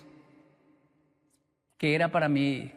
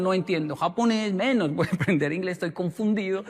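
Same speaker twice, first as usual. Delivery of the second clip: normal talking voice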